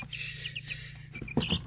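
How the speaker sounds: soft breathy shushing; whispered shh to soothe. Real, not acted. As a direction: hush the bird gently. Calming upset chick.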